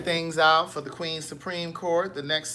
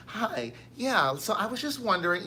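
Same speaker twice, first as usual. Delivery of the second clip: light raised customer service voice; 4 semitones higher, 4 dB quieter than usual. Shown as a demonstration. Customer service rep showing off her phone voice, light and polite. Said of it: "you got to change your pitch".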